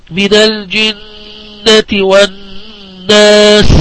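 slow recitation, long humming نّ in الجنة and والناس; tajweed ghunnah recitation. Shown as a demonstration. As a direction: chant it slowly, holding the doubled ن of الجنة and والناس in a nasal hum. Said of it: "حرف أغن مشدد".

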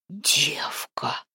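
whispered in shock; stunned whisper. A narrator's reading. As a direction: breathe it out, stunned. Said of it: "ошарашенно шепнул Гвидон".

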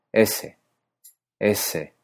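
S said with the tongue tip like northern Spain; an apical S; Castilian s. Shown as a demonstration. Teacher shows the Icelandic s sound. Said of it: "como en partes del centro y norte de España".

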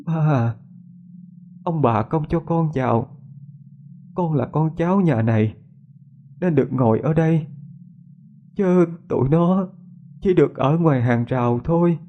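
put-on high eerie ghost girl telling her father who may sit inside; ghost daughter, sad and eerie. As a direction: speak from beyond, distant and hushed.

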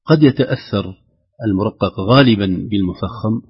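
ordinary talk

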